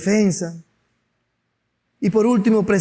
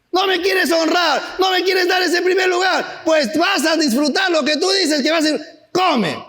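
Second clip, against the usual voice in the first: stern divine rebuke; 9 semitones higher than usual. Acted. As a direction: thunder it down at them. God voiced scolding people.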